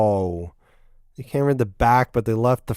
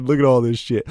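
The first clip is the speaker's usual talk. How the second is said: ordinary delivery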